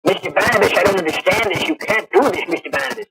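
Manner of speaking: a fussy, fretting scholar begging his host; host imitates his own flustered Professor Stokes character for the guest. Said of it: "And I do the lines like".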